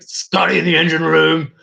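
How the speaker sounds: pushed-up impression with punched vowels; Alex Jones. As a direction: hit the vowels hard like a ranting host. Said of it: "the whole Alex Jones thing".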